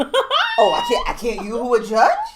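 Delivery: high squeal breaking into laughter; laughing squeal. Retold or imitated. Friend replaying the scene, high laughing squeal. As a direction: squeal the start, then laugh the line out.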